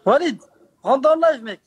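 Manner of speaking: loud call to a friend; yelling across the room. Real, not acted. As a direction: shout his name across the room. Calling a friend into stream.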